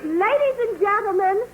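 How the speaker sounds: ordinary talk, nothing marked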